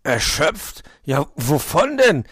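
high, loud, slow questioning; storybook fox probing in disbelief; puzzled fox. A narrator's reading. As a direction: inquire with exaggerated surprise. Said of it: "erkundigte er sich".

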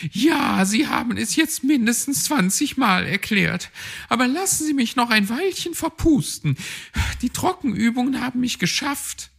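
high, fast weary magpie voice; exasperated and out of puff after drills; Frau Elster the magpie. A narrator's reading. Magpie begging Herr Fuchs for a rest, high and exasperated.

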